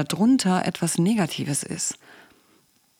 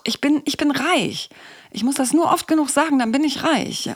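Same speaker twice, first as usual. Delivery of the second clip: high-pitched, emphatic insistence; acted desperate pep talk, pitched well up; defiant self-persuasion; 8 semitones higher, 3 dB louder than usual. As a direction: protest stubbornly against your inner doubt. Acted defiance of inner doubt.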